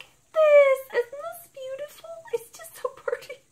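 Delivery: very high, squealing admiration; gushing delight. Real, not acted. Showing viewers the pretty box, squealing with delight. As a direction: coo over it like a treasure.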